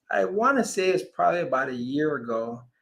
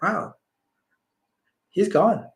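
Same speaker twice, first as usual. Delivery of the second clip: a touch higher, stunned and hushed; stunned realization; 4 semitones higher than usual. Retold or imitated. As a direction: let the realization land in disbelief. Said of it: "I said that internally to myself".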